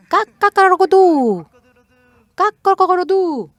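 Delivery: high, loud cock-a-doodle-doo falling at the end; rooster crow. Shown as a demonstration. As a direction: crow like an American rooster, twice. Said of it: "¿cómo canta el gallo?". (canto de gallo agudo y fuerte que cae al final)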